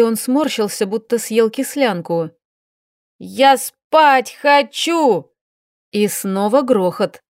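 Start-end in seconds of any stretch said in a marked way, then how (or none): 3.2-5.3 slow, high drawn-out wail; whiny shout. A narrator's reading. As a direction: wail it through the door. Maria shouting through door.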